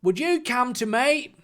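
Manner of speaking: polite attempt at an Australian voice; Australian accent. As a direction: ease into a put-on Australian accent. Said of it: "Australian's a difficult way".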